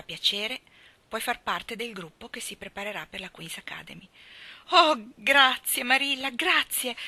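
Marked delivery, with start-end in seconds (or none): 4.7-7.0 overjoyed thanks. Acted. Anna thanks Marilla, overwhelmed.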